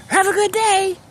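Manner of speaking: high, bright greeting called back; cheerful shout back. Retold or imitated. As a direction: wave and holler it back happily. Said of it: "We would wave back and say".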